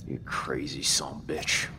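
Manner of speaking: hushed, breathy disbelief; whispered curse over the body. Acted. Grave robber whispers his disbelief to Dale.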